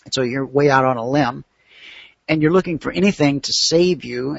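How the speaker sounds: ordinary delivery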